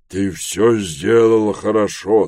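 slow, loud character voice; approving elder. Acted. Dom praises Trev's choice, slow and approving. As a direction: praise him slowly and forcefully.

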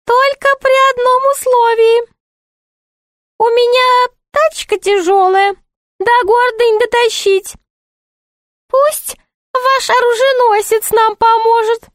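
very high, piping jester voice; high-pitched court jester haggling; bargaining jester. Acted.

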